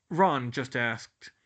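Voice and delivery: ordinary delivery, nothing marked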